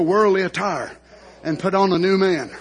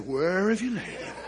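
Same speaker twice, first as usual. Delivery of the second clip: quietly retold question, softened; subdued question; 7 dB quieter than usual. Preacher voicing Jesus at Lazarus' death, subdued. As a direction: ask it softly, holding back.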